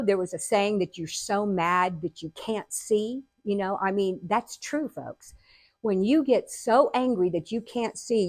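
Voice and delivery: usual speaking voice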